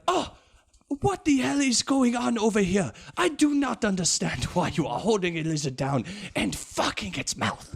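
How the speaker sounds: a guard stumbling on the scene in Indian English; mock Indian accent, indignant. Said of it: "the guy who caught him be like".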